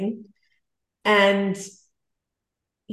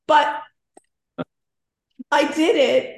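ordinary talk

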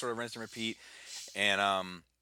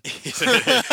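laughing; 14 semitones higher, 13 dB louder than usual. Real, not acted. Bursts out laughing.

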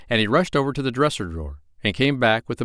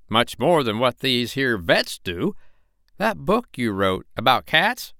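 chatty tradesman's voice, a bit higher; cat's meat man; 7 semitones higher than usual. Acted. Cat's meat man urging the doctor, lightly voiced eager hawker.

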